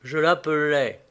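ordinary delivery

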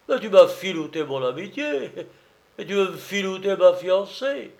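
outraged drum major, loud at the outset; angry accusation. Acted. Major threatening the false old comrade, angry and forceful. Major furious at being fooled.

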